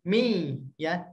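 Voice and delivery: usual speaking voice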